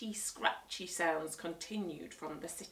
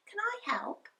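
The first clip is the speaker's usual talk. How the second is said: put-on eager little boy voice. Peter eager in story.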